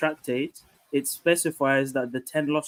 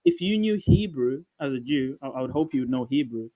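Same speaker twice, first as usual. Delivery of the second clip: ordinary talk, nothing marked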